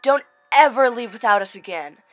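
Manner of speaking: loud scolding mother; yell. Acted. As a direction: shout it in relief. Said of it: "her mother yelled".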